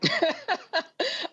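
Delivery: high-pitched burst of laughter; amused, high laugh; real laugh. Real, not acted. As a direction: crack up laughing at the story. Amused by the panelist's reading.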